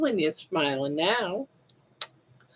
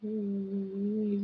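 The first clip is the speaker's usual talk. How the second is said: usual speaking voice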